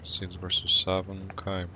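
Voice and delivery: normal talking voice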